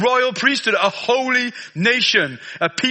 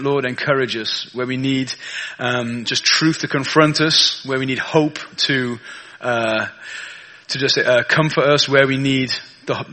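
prayer; 7 semitones lower than usual. Acted. Prays before the sermon.

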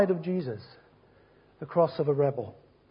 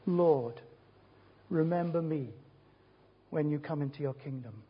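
soft, humble plea, trailing quieter; hushed plea; 4 semitones lower, 8 dB quieter than usual. Retold or imitated. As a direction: plead softly, as a dying man asking for mercy. Dying thief's plea to Jesus.

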